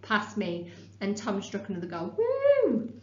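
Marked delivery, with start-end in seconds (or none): 2.2-2.9 excited cheer. Acted. Second cheer for the goal.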